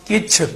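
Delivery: ਕਿਛ quick, as "kichh"; short i. Shown as a demonstration. Teacher drilling the word, ਕਿਛ with short i. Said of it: "ਕੱਛ ਨਹੀਂ ਕਹਿਣਾ".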